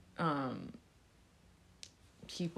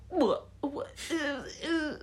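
high-pitched, sputtering disbelief; mock shocked fan; 11 semitones higher, slower than usual. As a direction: imitate a fan who can't believe it. Acts out fans' shocked reaction.

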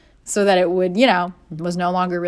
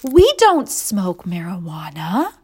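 much higher, slow, faux-innocent pleading; mock innocent girlfriend; 9 semitones higher, 4 dB louder, slower than usual. Girlfriend telling boyfriend the guards suspect marijuana, faux-innocent. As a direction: play innocent, overdoing it. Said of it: "I'm like".

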